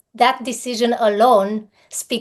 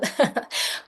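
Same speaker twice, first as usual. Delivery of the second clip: short, soft laugh; quiet little laugh of fellow feeling; light chuckle; 7 dB quieter than usual. Real, not acted. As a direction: chuckle briefly to the audience. Light laugh after bonding with audience.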